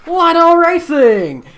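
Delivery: loud, slow ad read; sponsor read. Acted. Host reading the sponsor plug to viewers, loud slow ad voice. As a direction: sell the sponsor name big and slow. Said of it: "brought to you by".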